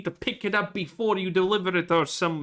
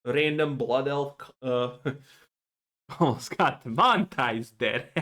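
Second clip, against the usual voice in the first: ordinary delivery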